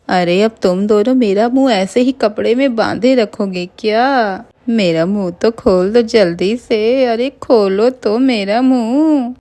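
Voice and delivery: slow, wavering storybook witch; sing-song witch demand with stretched endings. Acted. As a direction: draw out the words in an eerie wail. Laughing witch demands to be uncovered.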